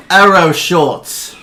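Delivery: slow, loud drawn-out name call; arena announcer. Acted. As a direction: stretch the name for the crowd. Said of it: "he goes".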